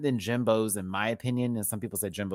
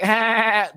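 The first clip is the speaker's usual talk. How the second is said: high, loud imitated character laugh; mock signature sitcom laugh; Nanny laugh; 10 semitones higher, 8 dB louder than usual. Mocking how everyone starts a Nanny impression, loud put-on laugh. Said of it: "the laugh is the key source of".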